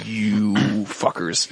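slow, low grumbled curse; mock curse. Losing the comic shop trip, slow mock curse. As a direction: drag out a sulky curse.

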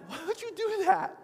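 shocked church lady, overdone for laughs; mock scandalized. Pastor imitating his congregation's gasp, scandalized church lady.

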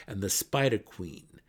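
ordinary delivery, nothing marked

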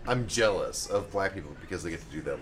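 ordinary talk

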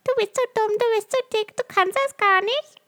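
very high squeaky cartoon mouse voice; high cartoon mouse voice mocking the critic; Mickey Mouse voice. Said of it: "der redet jetzt auf einmal".

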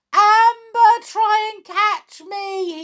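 high, loud plea; desperate shout. A narrator's reading. Tom hurtling toward Amber, shouting. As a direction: shout ahead for help. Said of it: "he shouted".

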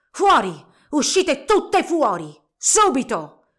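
very loud, slow angry commands; furious yelling. A narrator's reading. Old inventor chasing girls from his workshop, furious shouting. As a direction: shout them out of the room. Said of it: "sbraitando rabbiosa".

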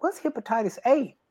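high, puzzled question. Retold or imitated. Asking the doctor about the diagnosis, high and puzzled. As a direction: ask it baffled. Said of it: "And I'm like".

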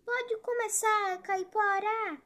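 high, loud, drawn-out call; shouting. A narrator's reading. Hidden tortoise calls the Caipora to begin, shouting. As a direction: call out slowly and loudly from afar. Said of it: "foi que gritou".

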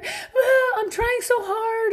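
high, whining mock cry; whiny crying. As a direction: wail it like a self-pitying parent. Whiny parent reaction mocked.

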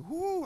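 one high-pitched whoop; short high hoot of delight; happy whoop. Retold or imitated. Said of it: "I get ha-, happy".

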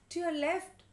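high, loud direction; urgent warning. A narrator's reading. Father directs grandmother to the mouse, urgent warning. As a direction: call it out sharply from above.

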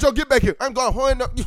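calling Pedro. Acted. Playing a character calling out.

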